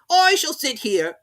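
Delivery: high, slow, haughty footman; lofty servant talking down; footman's voice. A narrator's reading. Footman repeats.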